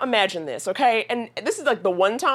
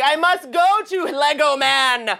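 slow, grand, higher and louder declaration; dramatic vow; 5 semitones higher, 4 dB louder, slower than usual. Retold or imitated. Herself mock-heroically vowing to reach Lego Man, slow and grand. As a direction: announce it like a hero's quest. Determined quest to reach Sven.

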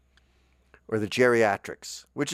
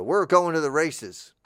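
louder, slow sergeant's announcement; sergeant announcing; 7 semitones higher, 6 dB louder than usual. Pretend. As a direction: announce it to your troops. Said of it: "I go".

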